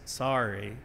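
low, soft, reluctant sorry; small grudging voice, imitated; grudging quiet apology. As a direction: mumble it like a child made to apologize. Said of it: "in that quiet voice".

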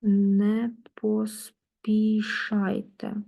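very slow Не поспішайте, stretched out; slow take your time. Shown as a demonstration. Teacher acting out the phrase "take your time" slowly. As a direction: say it as calmly and slowly as the meaning. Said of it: "take your time".

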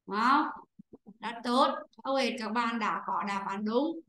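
ordinary talk

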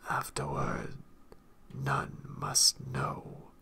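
very low, breathy, slow whisper; deep, secretive murmur, drawn out; confidential whisper. A narrator's reading. Shrive to the maestrum, for his ears only, low whisper. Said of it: "The next words were only meant for the man's ears".

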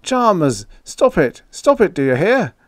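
high, loud repeated command; alarmed, insistent shouting; urgent cry. A narrator's reading. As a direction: shout to stop him. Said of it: "I cried".

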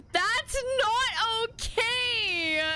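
high, slow, whiny protest; panicked wail. Real, not acted. Panic builds over repeated phrase.

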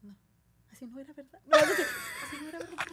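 high, laughing retraction; laughing backpedal. Retold or imitated. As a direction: take it back while laughing. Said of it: "y Gaby". (Gaby riéndose y retractándose)

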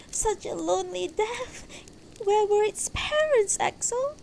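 very high, plaintive wail, acted; sobbing wife. A narrator's reading. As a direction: lament in a high, grieving woman's voice. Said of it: "Beatrice was now sobbing quietly".

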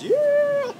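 high squeal of delight; excited squeal. Real, not acted. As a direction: squeal it out happily. Squeal of joy.

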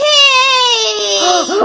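high crying gasp; sobbing gasp. Acted. Cake destroyed.

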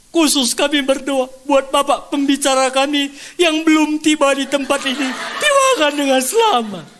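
high, loud mock public prayer; imitating someone praying at full voice; fervent prayer. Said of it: "Kami undang Bapak Kepala Sekolah berdoa".

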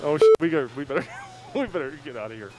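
usual speaking voice

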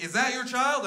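high, loud suspicious question; alarmed Karen. Acted. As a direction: interrogate as an outraged bystander. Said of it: "And then she was like".

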